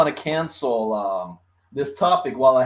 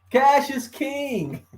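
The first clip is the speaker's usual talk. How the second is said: high, slow mocking sneer; 13 semitones higher, much slower than usual. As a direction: parody the smug critic. Mocking Bitcoin critics again.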